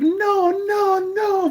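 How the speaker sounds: very high mock protest; squealed, delighted refusal in a grandma's voice; Busha. Busha grinning while refusing a dance, high playful protest. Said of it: "ear-to-ear grin on her face".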